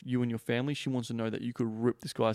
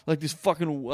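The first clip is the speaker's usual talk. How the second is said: ordinary delivery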